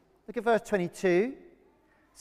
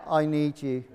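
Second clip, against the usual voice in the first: low monotone, deliberately lifeless; mock unenthusiastic; 7 semitones lower than usual. Preacher mimicking the congregation's limp response, deadpan and unenthusiastic. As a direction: imitate a half-hearted crowd, pitch dropped. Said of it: "that was really enthusiastic".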